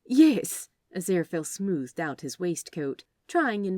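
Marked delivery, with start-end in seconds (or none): 0.1-0.7 flustered. Acted. Flustered reply.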